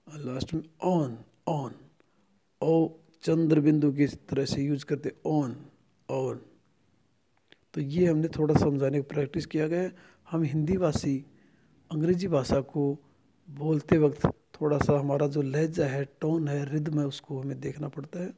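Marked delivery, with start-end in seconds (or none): none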